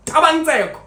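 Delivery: loud, high call of a name. Retold or imitated. Announcer calling the candidate's name, loud and high. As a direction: sing out the name across the room.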